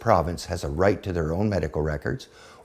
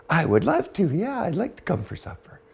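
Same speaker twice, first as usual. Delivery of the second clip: put-on giddy old mother; 8 semitones higher than usual. Mother giddy after brownie.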